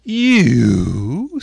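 loud, contemptuous scoff. A narrator's reading. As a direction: sneer the word out. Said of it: "said the caterpillar contemptuously".

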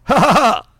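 loud, high imitation guffaw, then the name; imitated barber cracking up abruptly; barber's sudden laugh. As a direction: burst out in a mock belly laugh. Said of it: "jumps up. He goes".

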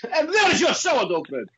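high put-on foreign-wife voice, broken English; hammed-up wife telling him off; mock angry wife.

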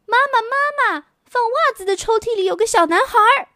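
high, fast, put-on child calling out; a little girl rushing to her mother; shouting girl. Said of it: "雪莉大叫着跑下楼".

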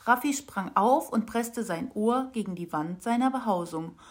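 plain reading voice

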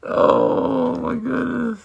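high, hammed-up gasp of surprise; mock shock. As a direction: play it up as scandalized. Mock shock at dropped soap.

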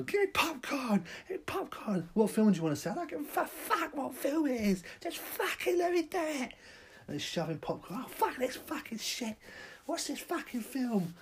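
very high, crazed shrill rant; manic popcorn demand. Acted. Madman at the cinema counter, very high crazed rant. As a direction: rant at the usher like a lunatic.

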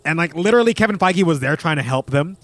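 usual speaking voice

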